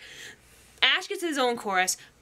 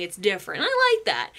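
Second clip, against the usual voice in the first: usual speaking voice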